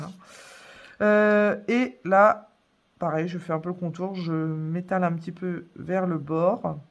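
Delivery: usual speaking voice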